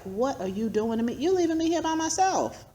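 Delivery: high, pleading and hurt; rising wail of abandonment; plaintive cry. Retold or imitated. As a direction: cry out as if left alone. Voicing her own grief.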